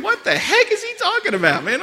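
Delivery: puzzled aside to yourself; baffled inner voice. Retold or imitated. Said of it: "And I'm thinking".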